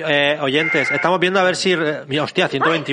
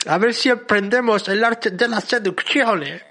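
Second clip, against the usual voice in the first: put-on higher, slow, laughing foreign accent; half English, half Italian accent; 6 semitones higher, slower than usual. Host admits the accent slipped toward Italian, mixed mock accent. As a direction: mangle it between English and Italian. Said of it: "me salió medio inglés, medio italiano".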